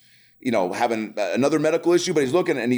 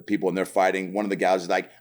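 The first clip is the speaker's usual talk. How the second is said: normal talking voice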